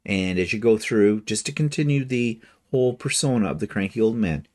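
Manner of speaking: ordinary delivery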